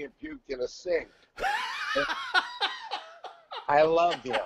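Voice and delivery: laughing through the story. Real, not acted. Telling about Ozzy puking, laughing. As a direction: chuckle while telling it.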